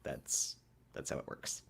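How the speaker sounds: sarcastic whisper. Real, not acted. Deadpan sarcastic aside.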